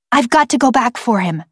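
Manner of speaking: lightly voiced determined child; a touch of childish urgency; insistent girl. A narrator's reading. Girl insists on rescuing cousin.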